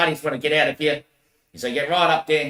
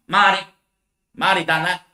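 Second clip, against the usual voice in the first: mock call, higher and louder; calling out Marty; 5 semitones higher, 4 dB louder than usual. The Lord calling out for Marty, mock call. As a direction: sing out the name like a summons.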